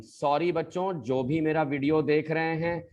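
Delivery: ordinary talk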